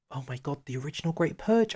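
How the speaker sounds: real surprised remembering; real sudden recall. Real, not acted. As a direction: exclaim as the forgotten thing comes back. Suddenly recalls a forgotten focus.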